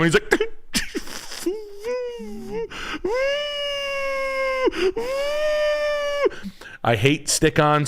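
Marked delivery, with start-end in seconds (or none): 0.3-6.5 long, very high shrieking laugh. Real, not acted. Host cracking up, long high shriek. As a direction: laugh up in a squeal.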